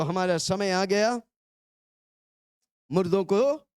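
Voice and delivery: firm call-and-response cue. Shown as a demonstration. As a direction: say it out firmly for the crowd to repeat. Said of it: "जोर से बोलो".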